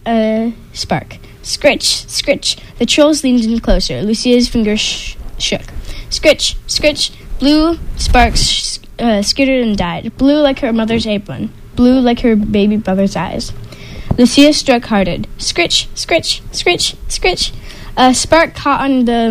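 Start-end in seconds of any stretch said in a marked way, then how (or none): 1.4-2.6 scritch sound. A narrator's reading. Striking tinderbox. 6.1-7.1 high airy scraping; high scratchy scritch; scritch sound. A narrator's reading. Striking tinderbox. 15.4-17.5 high airy scraping; scraping flint sound; scritch sound. A narrator's reading. Striking harder.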